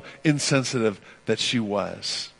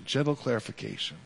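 ordinary talk, nothing marked

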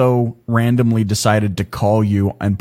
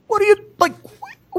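high-pitched exasperated dad. Retold or imitated. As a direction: voice shooting way up in disbelief. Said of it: "why is my voice getting so high right now?".

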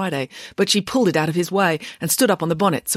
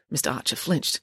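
ordinary delivery

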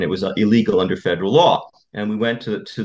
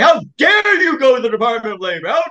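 high, loud mock yell of a furious judge; imitating an angry shout; 12 semitones higher, 5 dB louder than usual. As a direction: bark it like the judge scolding the lawyer. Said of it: "He was shouting at me".

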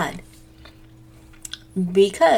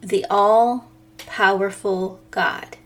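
slow emphasis; slower than usual. Acted. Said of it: "stressed slow phrase".

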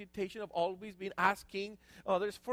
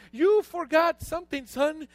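loud, high reproach; mock indignant father; 6 semitones higher, 7 dB louder than usual. Father reminds son who provides, loud mock indignation. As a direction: scold him with hammed-up force.

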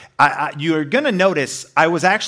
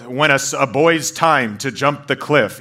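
a lightly voiced cryptic sage; Wu-Tang proverb. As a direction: intone it like a riddle master. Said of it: "It's like a Wu-Tang proverb".

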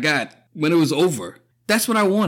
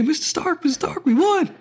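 put-on high young pleading voice; imitating Peter Parker; 12 semitones higher than usual. Fan voicing Peter at Tony's death, high and pleading. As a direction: plead like a kid at a dying mentor. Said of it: "Peter's right there".

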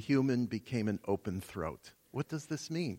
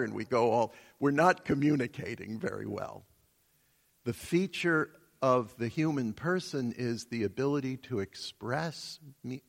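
ordinary delivery

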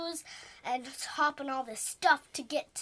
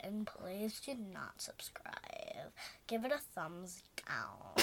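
whispering; 7 semitones lower, 9 dB quieter, slower than usual. Acted. Whispering anti-sign-off.

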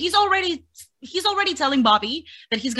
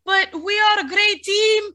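whiny Nikki; 9 semitones higher, slower than usual. Retold or imitated. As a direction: ham up her desperate begging. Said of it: "Nikki was like".

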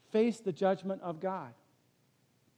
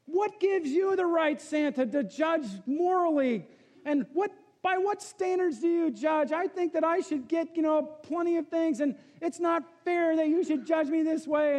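indignant Calvin; 9 semitones higher than usual. Retold or imitated. Calvin's letter demanding justice from Santa, indignant shout. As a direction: rant at Santa like an outraged kid. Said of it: "he's writing this letter to Santa".

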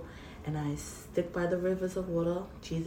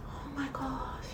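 awed whisper; 9 semitones higher, 8 dB quieter, slower than usual. Retold or imitated. Overwhelmed by God's promise.

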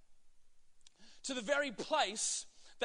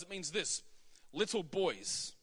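normal talking voice

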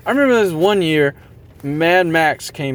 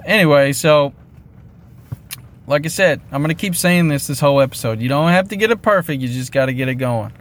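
ordinary talk

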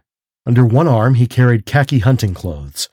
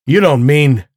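louder and higher cry of shock; 4 semitones higher, 5 dB louder than usual. A narrator's reading. Rainsford cries out at the general, louder and higher. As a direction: blurt it out in alarm. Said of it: "cried Rainsford".

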